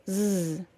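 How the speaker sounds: "z" as a long voiced buzz; isolated Z sound. Shown as a demonstration. Teacher shows today's sound, "z" as a sustained buzz. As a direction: hold "z" alone with the vocal cords buzzing. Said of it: "and the Z sound".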